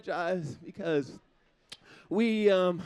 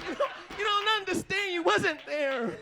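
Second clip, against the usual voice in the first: usual speaking voice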